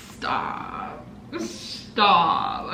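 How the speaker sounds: voiceless, hushed disbelief; stunned whisper. Real, not acted. Gift recipient amazed at perfect condition, whispering. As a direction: whisper it, as if lost for words.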